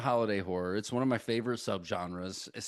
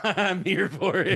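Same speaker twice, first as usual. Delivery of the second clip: laughing; 9 semitones higher, 6 dB louder, slower than usual. Real, not acted. Laughing at his own joke.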